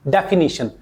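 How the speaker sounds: डेफिनेशन said as de-fi-NI-shun; correct pronunciation. Shown as a demonstration. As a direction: say डेफिनेशन with an "ee" before the final shun. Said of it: "कैसे pronounce करोगे". (डेफिनेशन का सही उच्चारण, "डे-फ़ि-नी-शन")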